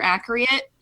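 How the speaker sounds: "accurate" with an extra ee sound, ak-yer-ee-it; mispronounced accurate. Real, not acted. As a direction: stumble "accurate" into ak-ree-it. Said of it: "That's how you say it in".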